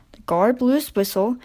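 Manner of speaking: ordinary delivery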